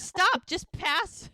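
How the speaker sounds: high, loud, drawn-out plea; amused protest. Retold or imitated. Amelia asks for the sheet, high mock protest. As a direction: protest high and slow, acting out embarrassed amusement. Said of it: "Amelia laughs".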